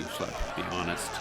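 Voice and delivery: breathy, soft whispered urging. Acted. Friend privately begs Schlepp to confess, whispering. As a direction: lean in and whisper it.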